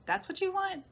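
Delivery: high, amused mock reply; lightly voiced universe, pitched high; voicing the universe. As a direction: play the universe humoring the asker. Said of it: "the universe is like".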